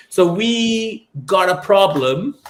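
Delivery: slow, drawled American accent. Real, not acted. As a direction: say it like an American movie line. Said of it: "I don't know why I said that in an American accent".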